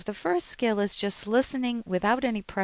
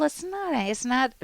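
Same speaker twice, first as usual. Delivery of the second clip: rethinking; 6 semitones higher than usual. Pretend. Correcting the label, higher pitch.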